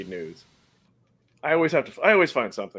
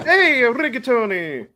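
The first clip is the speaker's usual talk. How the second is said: put-on slow, higher, louder Italian accent greeting; 9 semitones higher, 5 dB louder, slower than usual. Host greeting a chat regular in a playful Italian accent. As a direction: greet the viewer like a hammed-up Italian. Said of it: "like, an Italian accent?".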